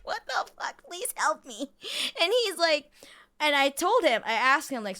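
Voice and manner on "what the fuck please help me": high squeaky plea; exasperated at the vague doctor; mock despair. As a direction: whimper it like a helpless kid. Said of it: "And, like".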